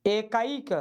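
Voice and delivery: एकाइक as e-kaa-ik; correct pronunciation. Shown as a demonstration. Teacher models word for students, careful pronunciation. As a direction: clear i glide before the final k of एकाइक. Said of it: "एकाइक नहीं".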